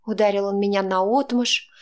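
plain reading voice

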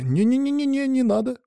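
very high string of refusals; hurried backtracking. A narrator's reading. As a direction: back down instantly in a high squeak. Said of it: "тут же переменился Саня". (торопливо отнекивается, очень высоко)

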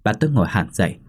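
ordinary delivery

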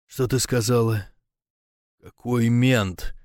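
stunned croak, words dragged out; halting, choked disbelief; hoarse, barely breathing. A narrator's reading. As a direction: ask it as if winded. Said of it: "прохрипел, еле дыша".

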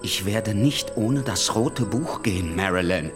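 low, slow, quiet threat; menacing demand. Acted. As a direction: refuse to budge, low and deliberate. Kennedy threatens Marilyn in quarrel. (leise drohend)

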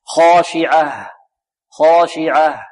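"خاشعة" ending in an audible h; slow "خاشعة", finished on a clear ha; clear final ha. Shown as a demonstration. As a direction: breathe out a distinct h at the end of "خاشعة". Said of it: "ولا بد من إيضاح الهاء".

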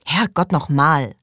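finally fed up with waiting for him; exasperated outburst. A narrator's reading. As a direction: lose patience and let it out. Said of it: "rief sie schließlich".

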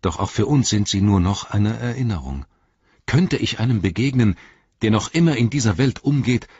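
high, fervent elf voice; impassioned exclamation. A narrator's reading. Legolas defends the old songs of Onodrim, impassioned. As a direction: protest with rising fervour. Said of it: "rief Legolas".

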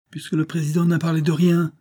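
répète sèchement, plus haut et plus fort; avec colère. A narrator's reading. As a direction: une colère qui monte, agacé. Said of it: "répéta Raphaël avec colère". (répétition en colère, voix haussée)

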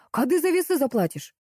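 high, demanding question; mild shout for payment; calling out. A narrator's reading. Coworker demands money from the butcher, calling out. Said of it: "закричали сбоку".

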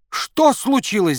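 high loud slow yell; shouting coach. A narrator's reading. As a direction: bellow in alarm. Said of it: "заорал тренер".

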